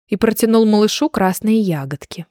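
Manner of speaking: ordinary delivery, nothing marked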